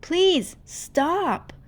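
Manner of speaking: high, loud desperate plea; begging. Acted. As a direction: plead for them to stop. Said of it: "Rumi begged".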